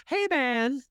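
high, polite opener; meek hey man. Retold or imitated. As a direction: greet a threat like a buddy. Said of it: "I open with".

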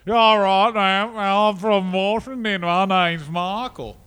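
mock the wrestler in a cartoonish Australian accent; Michael Hayes in a bad Australian accent. Podcaster mocking a wrestler's fake origins, bad Australian accent. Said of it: "that's what he sounds like".

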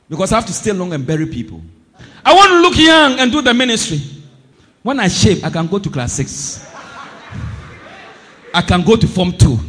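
ordinary talk, nothing marked

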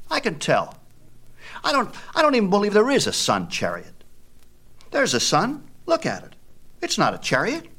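put-on high, fast doubting boy's voice; a kid calling out a lie; scoffing boy Epaphus. A narrator's reading.

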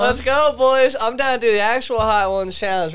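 loud hyped-up bro shout; frat bro. Real, not acted. Hyped up for hot sauce challenge.

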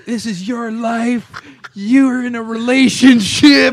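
high, slow, drawn-out mock screaming; imitated scolding yell. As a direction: wail it like outraged friends telling her off. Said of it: "they're, like, yelling at her".

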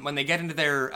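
ordinary talk

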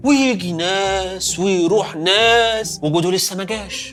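very slow, stretched-out words; slow, wearily elongated summary; tedious drawn-out recap. Acted. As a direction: drag every phrase out as if the waiting never ends. Mimics the play's endless waiting.

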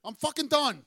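loud, furious rant about the injured player; angry yelling. Real, not acted. Fed-up fan yelling to his co-hosts about the injured star. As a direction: yell it out, fed up and done with him. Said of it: "Why are you yelling at me?".

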